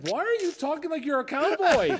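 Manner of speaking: exasperated outburst. Real, not acted. Exasperated complaint at remake.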